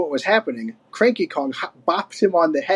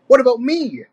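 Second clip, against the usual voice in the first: indignant protest; 8 semitones higher, 3 dB louder than usual. A narrator's reading. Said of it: "stamping his foot".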